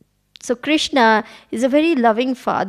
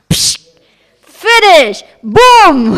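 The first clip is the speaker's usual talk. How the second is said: loud, high mock explosion; hammed-up boom; explosive sound effects; 6 semitones higher, 10 dB louder, much slower than usual. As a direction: burst it out like a blast. Demon bursts into pieces.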